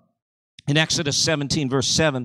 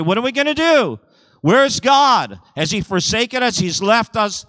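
high, urgent mock panic; panicked complaint; 8 semitones higher, 4 dB louder than usual. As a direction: cry out as people who feel abandoned. Acts out hungry, panicking people.

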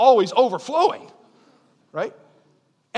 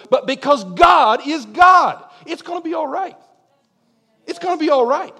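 emphatic proclamation; 6 semitones higher, 7 dB louder than usual. Acted. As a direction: proclaim it to the room. Climax of sermon.

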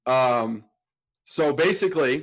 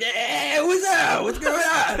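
put-on drunk buddy voice; 14 semitones higher, much slower than usual. Roommate greeting Greg at 4 a.m., high drunk cheer. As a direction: play the blacked-out friend answering the phone cheerily. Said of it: "He's like".

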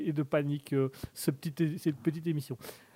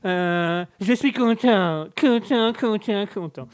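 award acceptance speech; 4 semitones higher, 6 dB louder, slower than usual. Pretend.

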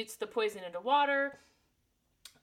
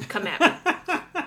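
mock challenge; 5 semitones higher, 7 dB louder, much slower than usual.

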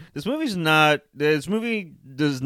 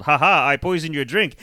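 a hint of a smug movie villain, slightly louder; gloating villain; 3 semitones higher, 4 dB louder than usual. Villain revealing the poisoned drink to Eggsy, lightly hammed gloat. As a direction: play the villain cheerfully revealing his trick.

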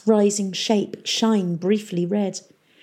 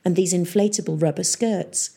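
ordinary delivery, nothing marked

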